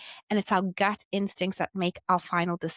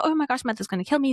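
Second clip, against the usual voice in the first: fast, higher mock panic; Rapunzel's panic; 5 semitones higher, much faster than usual. Rapunzel fretting that her mother will kill her, in mock panic. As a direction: rattle it off in a playful fright. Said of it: "Rapunzel from Tangled".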